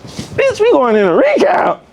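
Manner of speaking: high, alarmed bitch we going into recount; rising panic at the recount news; panicked disbelief. Retold or imitated. Stuck at the prison door needing the toilet, high alarmed disbelief.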